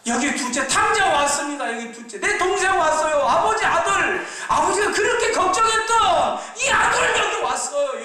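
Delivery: high, loud, drawn-out calling; older brother shouting to the father that the lost son is back; ringing shout. Acted. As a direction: call out to the father far away, ringing. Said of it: "쩌렁쩌렁하게 들립니다".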